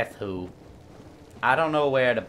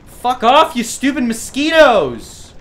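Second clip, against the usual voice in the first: high, loud frustrated shout; angry yell; 12 semitones higher, 11 dB louder than usual. Real, not acted. Gamer yelling at swarming mosquitoes, angry. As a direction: yell at pests to get away.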